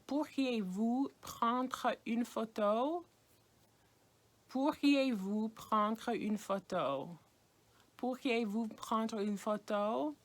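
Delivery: Pourriez-vous prendre une photo, slow, rising at the end; slow French question. Shown as a demonstration. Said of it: "the way you would say that is".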